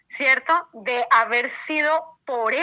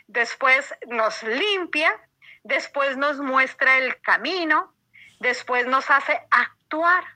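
slow deliberate listing. Acted. Listing God's steps slowly.

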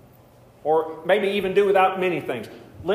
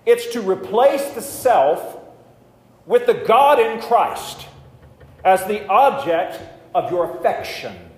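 fervent preaching; 6 semitones higher, 6 dB louder than usual. Real, not acted. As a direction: drive the point home to the congregation. Preaching at full force.

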